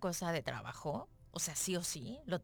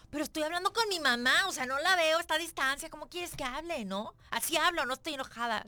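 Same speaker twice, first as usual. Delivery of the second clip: higher, louder indignant self-defence; replaying how she argued back, a touch exaggerated; retold loud protest; 6 semitones higher, 5 dB louder than usual. Defending her loud call with mom to her companion, mock indignant protest. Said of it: "Y yo".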